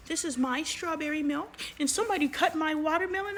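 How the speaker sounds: put-on high, whiny little boy complaint; whiny kid quote. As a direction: mimic a sulky kid griping about his milk. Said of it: "he was like".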